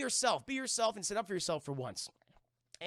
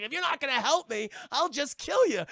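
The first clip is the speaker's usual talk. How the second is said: villain threat; 7 semitones higher, 7 dB louder than usual. Retold or imitated.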